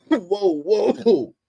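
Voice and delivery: high, drawn-out whoa of disbelief; stunned whoa. Real, not acted. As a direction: pull back in shock. Reacting to coach's words.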